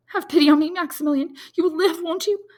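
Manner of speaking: high, loud desperate plea; pleading cry. A narrator's reading. Valentine begs Maximilian to live, high and loud pleading. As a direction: beg him with everything. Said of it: "she cried".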